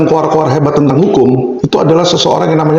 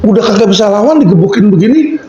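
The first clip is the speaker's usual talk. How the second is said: ordinary talk, nothing marked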